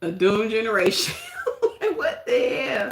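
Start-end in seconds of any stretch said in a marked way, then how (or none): 1.2-1.9 high laugh. Real, not acted. Laugh at doomed generation.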